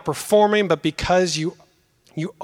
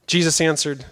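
ordinary talk, nothing marked

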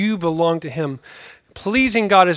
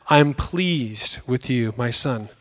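tender father; 6 semitones lower, 4 dB quieter, slower than usual. Acted. God the Father's voice quoted.

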